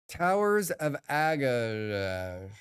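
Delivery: slow, halting "Towers of Aga-"; slowly sounding out the name; trying out a name. Shown as a demonstration. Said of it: "how the hell do they say this?".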